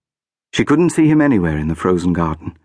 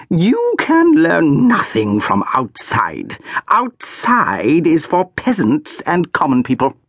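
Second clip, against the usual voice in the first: high, slow, icy disdain; haughty archduchess; 9 semitones higher, slower than usual. A narrator's reading. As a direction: sneer at the peasants. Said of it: "asked a haughty voice".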